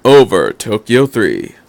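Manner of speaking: ordinary delivery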